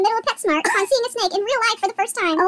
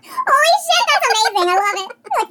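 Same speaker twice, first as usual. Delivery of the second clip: ordinary delivery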